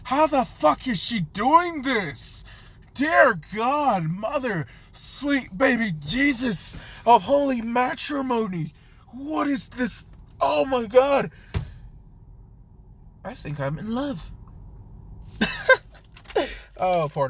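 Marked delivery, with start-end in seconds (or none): 0.0-14.3 gushing in stunned delight; high ecstatic exclamations; ecstatic awe. Acted. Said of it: "Naruto's just like". 14.3-15.8 high wheezing giggle. Real, not acted. Narrator cracks up.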